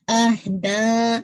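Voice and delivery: اہدا very slow, دا held four to five counts; long madd. Shown as a demonstration. Teacher showing the student how to stretch اہدا. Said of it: "four to five count پڑھنا ہے".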